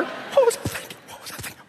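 voiceless, fading to nothing; breathless fading. Retold or imitated. Underwater, voiceless and fading to nothing. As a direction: gasp the words out as they die away.

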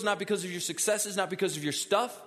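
loud, high-pitched, driving sermon climax; full-force preaching. Acted. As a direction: build each repeated phrase to a forceful peak.

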